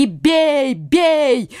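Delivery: drawn shout within narration; crowd's cries. A narrator's reading. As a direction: call out like distant pursuers. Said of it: "несмотря на крики".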